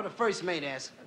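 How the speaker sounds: usual speaking voice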